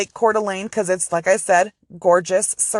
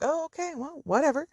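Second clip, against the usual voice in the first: high, soft, offhand brush-off; a breezy imitation of an operator who couldn't care less; mock dismissive operator; 7 semitones higher, 8 dB quieter than usual. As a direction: shrug it off like it's nothing.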